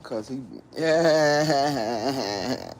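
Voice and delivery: ordinary talk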